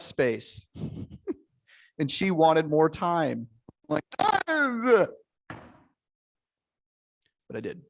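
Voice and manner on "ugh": high, loud exasperated groan; frustrated ugh. Retold or imitated. Groaning in frustration at wife pausing the fight. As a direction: let out a fed-up ugh at the delay.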